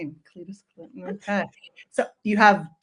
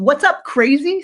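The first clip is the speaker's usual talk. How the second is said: playful louder repeat; 6 semitones higher, 6 dB louder than usual. Retold or imitated. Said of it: "he just called his wife".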